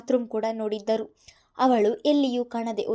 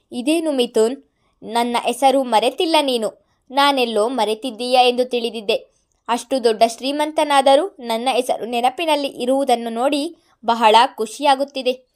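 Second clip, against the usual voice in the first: louder, sneering sarcasm; every word a jibe; sarcastic; 9 dB louder than usual. A narrator's reading. Madan mocking rich Mithun, sarcastic and loud. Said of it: "ಕುಹಕವಾಗಿಯೇ ಇತ್ತು".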